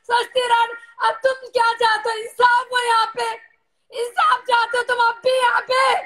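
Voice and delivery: real anguished indignation, hammering each phrase; angry hurt outburst. Real, not acted. Angry, hurt outburst at online abusers.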